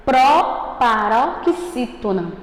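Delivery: "Proparoxítona" devagar, sílaba por sílaba; pronúncia bem direitinha. Shown as a demonstration. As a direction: articule "Proparoxítona" com cuidado, pro-pa-ro-xí-to-na. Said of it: "você pronunciá-la bem direitinho". ("Proparoxítona" lento e bem articulado)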